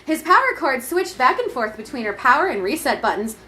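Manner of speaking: ordinary talk, nothing marked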